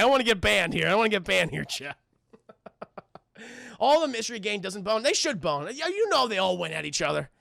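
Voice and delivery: usual speaking voice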